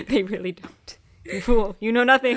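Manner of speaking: playful mock insult; mock taunt. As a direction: jeer it like a cartoon villain.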